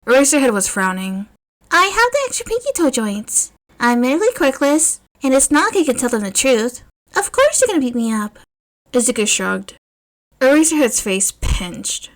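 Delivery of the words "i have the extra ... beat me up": put-on higher, lighter boyish voice; young boy voice. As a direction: voice a resigned young kid. Narrator voices young Izuku.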